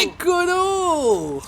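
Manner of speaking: long drawn-out shout. Real, not acted. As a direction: call it out long and loud. Calling out again.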